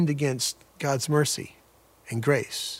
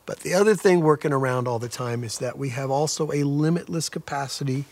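usual speaking voice

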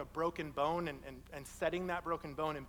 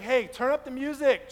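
loopy patient; 5 semitones higher, 9 dB louder than usual. Retold or imitated. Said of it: "he was like".

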